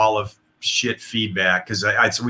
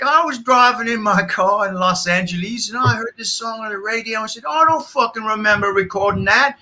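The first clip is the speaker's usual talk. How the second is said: put-on terrible [name] accent; 8 semitones higher than usual. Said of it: "I'm gonna do a terrible accent".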